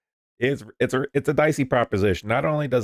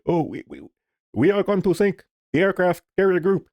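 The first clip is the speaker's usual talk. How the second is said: higher, hammed-up Middle Eastern accent boast; mock Middle Eastern accent; 8 semitones higher than usual. Houthi rebels boasting on social media, in a mock Middle Eastern accent. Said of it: "their fucking Middle Eastern accent would be, but fuck it".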